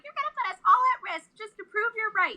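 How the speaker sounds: high, fast mock rant as the husband; mocking angry husband. Quoting her mad husband, a high fast mock rant. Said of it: "He's like".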